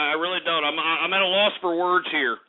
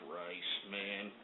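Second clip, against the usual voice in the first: whispered, much lower and softer; exasperated whisper; 12 semitones lower, 20 dB quieter, much slower than usual. Real, not acted. Host whispering in despair at callers. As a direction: breathe it out in despair.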